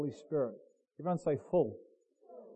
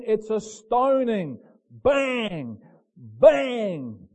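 loud, high, drawn-out preaching; full-force preaching; 6 semitones higher, 7 dB louder, slower than usual. Real, not acted. Preacher tells the congregation of a slow stoning, preaching at full force. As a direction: drive each phrase home to the congregation.

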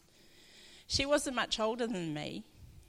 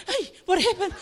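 much higher, louder, laughing surprise; mock alarm breaking into laughter; startled laugh; 10 semitones higher, 7 dB louder than usual. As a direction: jump as if jolted awake. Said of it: "and you'd go".

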